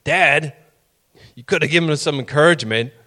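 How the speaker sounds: mild, fast mock complaint from mom; mom's reproach. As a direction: quickly nudge Dad to be kinder. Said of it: "It's like".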